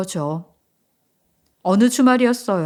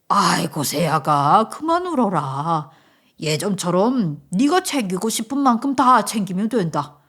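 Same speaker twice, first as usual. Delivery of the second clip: calm a crying daughter-in-law; soothing father-in-law; 3 semitones lower than usual. Acted. (며느리를 달래는 시아버지)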